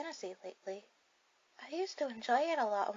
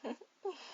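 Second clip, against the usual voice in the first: giggle; 5 semitones higher than usual. Acted. Reacting to date's silly answer.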